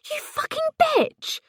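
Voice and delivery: a very high, shrieked insult; furious scream. A narrator's reading. Ellie losing it with Paisley on the phone, furious shriek. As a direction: explode in a shrieking curse.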